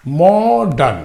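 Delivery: Modern drawn out as two separate syllables, "mo-dan"; split into syllables. Shown as a demonstration. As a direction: say modern slowly, stretching the first syllable before the second. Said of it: "two syllable".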